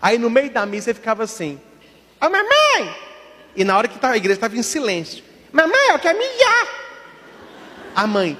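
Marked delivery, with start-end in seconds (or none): 2.2-2.9 put-on high, piercing call of a small child; shouting little boy. Little altar boy calls his mom mid-mass, high childish shout. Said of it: "ele só gritava". 5.5-6.7 put-on high, piercing call of a small child; shouting little boy. Little altar boy calls his mom mid-mass, high childish shout. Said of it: "ele só gritava".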